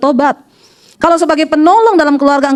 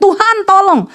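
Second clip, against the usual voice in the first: higher-pitched cry for help without real volume; mild retold cry; 6 semitones higher, slower than usual. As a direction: imitate a husband calling out to God. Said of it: "dengar suami saya teriak". (tiruan teriakan minta tolong, nada tinggi)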